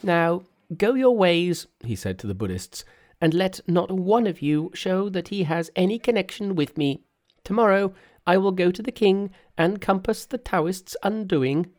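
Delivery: high-pitched Monkey, giving orders; commanding Monkey. Acted. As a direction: play a high-voiced, confident Monkey. Monkey addressing the Buddhists.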